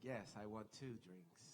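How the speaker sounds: low, slow, mock-smitten answer; imitated won-over reply. Person at the bar accepting the offer, mock-smitten. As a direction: drag it out low, as if charmed. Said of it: "they'll be like".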